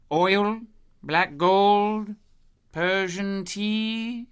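very slow, spelling it out to a dull listener; drawn-out hint. Acted. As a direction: stretch it out, heavy with meaning. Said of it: "explaining oil by hint".

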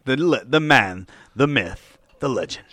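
slow, grand hype voice; dramatic announcer. Pretend. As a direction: build it up like a movie trailer. Hyping up a legend.

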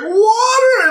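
very high, loud laughing wail; hammed-up wail. Soldier taking off his shoe, high loud mock wail. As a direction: wail the word like a cartoon.

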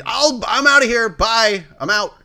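high, loud, hasty exit voice; mock quitter. Mocking the writer's quote as a dramatic quitter, high and loud. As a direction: ham up a huffy storm-off. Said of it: "You jumped".

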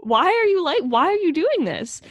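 high, loud, incredulous questioning; outraged disbelief. Real, not acted. Host reacting to the bully's cruelty, outraged disbelief. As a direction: squeal it in indignant disbelief.

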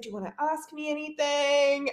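high, sweetly coaxing imitation. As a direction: act out a star gently inviting a question. Voices [name] coaxing a starstruck worker.

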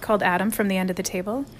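ordinary delivery, nothing marked

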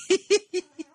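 squealing laugh; laughing in disbelief; high burst of laughter. Real, not acted. Irony of prophet claim.

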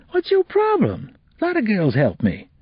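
high, scornful street voice; irritated teen boy. Acted. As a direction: scoff at her refusal. Kevin irritated.

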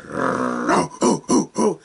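gruff mock bark; dog barking. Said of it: "the dog stood up and was just like".

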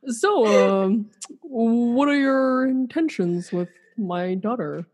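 slow, stern dad asking intentions; overprotective dad. Pretend. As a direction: play the protective father, half laughing. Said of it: "I'll be Dirk".

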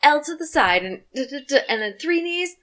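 high, sing-out instructor calls; imitating a class leader's high-pitched cueing; step class instructor. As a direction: call out step moves like an instructor. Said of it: "Yeah, she's like".

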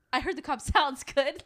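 mimicking Carrie, laughing. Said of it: "she goes".

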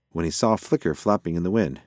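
plain reading voice

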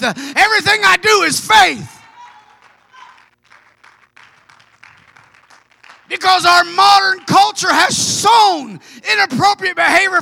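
rising, ringing preacher's cadence; preaching at full force. Acted. Preacher stirring the congregation to act in faith, full force.

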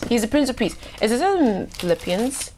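ordinary talk

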